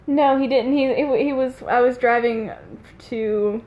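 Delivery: ordinary delivery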